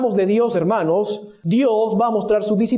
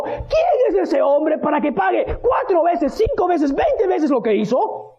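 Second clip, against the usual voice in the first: high-pitched indignant demand; acted furious outcry; 7 semitones higher than usual. Preacher voicing David's fury at Natán's story, indignant outcry. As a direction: act out outrage, pitch raised throughout. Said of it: "tan furioso, Se colocó rojo y comenzó a gritar".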